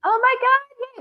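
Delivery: high, loud burst of delight; excited gasp. Real, not acted. Friend hearing of a surprise gift, high thrilled squeal. Told she gets a surprise book.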